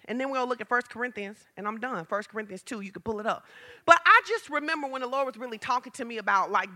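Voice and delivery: normal talking voice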